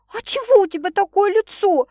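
high, loud indignant woman. A narrator's reading. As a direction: challenge him, suddenly affronted. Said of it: "возмутилась вдруг любимая".

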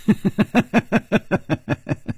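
high falling cruel laugh; mocking cackle. A narrator's reading. Gong Nanyan taunting the dying Bear Lady, mocking cackle. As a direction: laugh down at the victim. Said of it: "她不停地笑".

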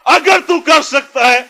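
high, loud indignant shout; holy anger. Acted. Preacher voices Jesus answering the doubting father, holy anger. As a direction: thunder it out in offended disbelief. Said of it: "Jesus is having holy anger inside".

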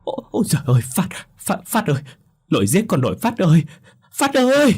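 wailing grief, climbing very high; sobbing wail. A narrator's reading. Grandmother wails over her dead grandson. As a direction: sob out the boy's name. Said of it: "Bà mếu máo mà khóc nấc".

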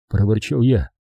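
ordinary delivery, nothing marked

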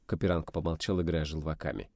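ordinary delivery

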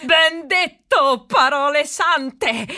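loud, slow, stretched-out cheer; emphatic approval. Acted. Applauding a fellow speaker's hygiene sermon, loud and slow. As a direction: boom it out as if blessing the words. Hearty agreement on hygiene speech.

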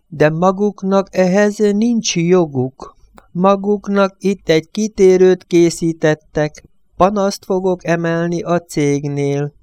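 high, slow protesting priest; pleading priest. Acted.

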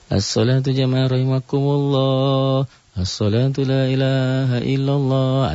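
slow, melodious chanted call; slow sung call to prayer; pleasant prayer call. Shown as a demonstration. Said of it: "dengan suara yang enak aja".

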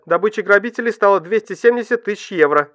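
crisp newsreader figure; news read. A narrator's reading. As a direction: announce the sum like news.

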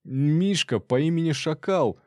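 high, mildly mumbled protest; mumbling robber. A narrator's reading. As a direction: moan it out from underneath an attacker. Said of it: "промычал тот".

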